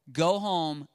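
usual speaking voice

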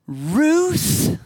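high, loud rising Ruth? full of reproach; shaming. Shown as a demonstration. Parent shaming a disobedient child by name, loud and high. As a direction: say Ruth like an exasperated accusation. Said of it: "in a shaming way".

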